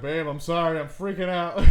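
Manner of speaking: freaking out. Retold or imitated. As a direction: act out panicked apologising to a partner. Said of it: "I'm fucking freaking out".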